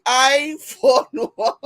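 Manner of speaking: slow, high, breaking into laughter; cracking up. Real, not acted. Repeating a viewer's joke, cracking up. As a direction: stretch it out and burst out laughing. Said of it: "cracks me all the way up".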